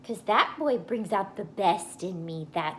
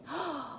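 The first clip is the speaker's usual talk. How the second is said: shocked gasp; 7 semitones higher, 7 dB quieter than usual. Acted. Mouth came all the way open.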